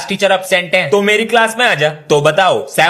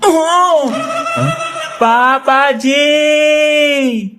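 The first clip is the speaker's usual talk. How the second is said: slow drawl; 7 semitones higher, much slower than usual. Acted. Boy drawling at the door.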